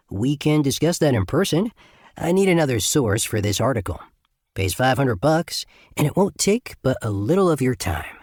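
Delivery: breezy, upbeat sales pitch; cheerful, easygoing reporter's pitch. Acted. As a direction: keep it light and cheerful to hook him. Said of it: "Keeping my tone cheerful".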